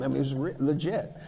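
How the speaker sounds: ordinary talk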